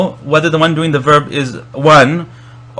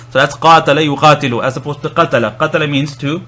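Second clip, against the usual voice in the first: ordinary talk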